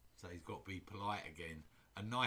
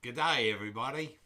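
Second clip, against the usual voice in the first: a light, loud Australian "Good day" to viewers; Australian accent; 10 dB louder than usual. Pretend. Streamer greeting viewers in a mock Australian accent. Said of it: "Shall we become Australian?".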